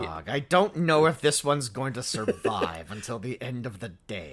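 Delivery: low, soft mock confidential aside; director's hushed aside. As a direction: lean in and murmur privately to the producer. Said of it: "leaned over the producer and was like".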